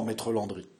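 usual speaking voice

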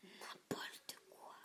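slow, whispered small voice; tiny voice. A narrator's reading. Gaspard answers his brother in a tiny whispered voice. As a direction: barely breathe it, tiny and slow. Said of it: "d'une toute petite voix".

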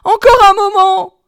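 high, loud desperate plea; wife crying out for delay; frightened pleading. A narrator's reading. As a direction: beg for time in panic. Terrified wife begs for delay.

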